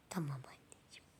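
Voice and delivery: usual speaking voice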